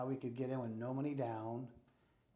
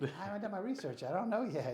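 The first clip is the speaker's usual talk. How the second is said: pitched up and flustered, caught off guard; 8 semitones higher, 5 dB louder than usual. Retold or imitated. Young agent flustered by the landlord's question, higher and louder. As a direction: blurt it out like a nervous rookie.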